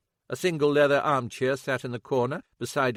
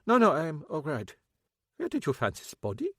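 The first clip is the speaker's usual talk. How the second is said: composed German ex-husband; 6 semitones higher than usual. Acted. Kappelhoff steadies himself.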